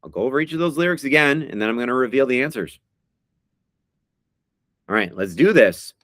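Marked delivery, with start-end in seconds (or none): none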